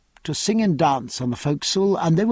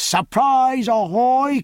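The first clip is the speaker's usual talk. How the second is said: anxious hail; 8 semitones higher, slower than usual. A narrator's reading. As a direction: call the ship, growing anxious. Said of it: "full pitch of his powerful voice had an edge of anxiety".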